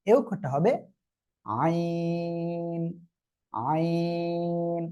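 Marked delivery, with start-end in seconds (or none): 1.4-4.8 আইন with the throat squeezed, long-drawn; throat-pressed ain. Shown as a demonstration. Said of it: "গলাটা চেপে আপনি উচ্চারণ করবেন".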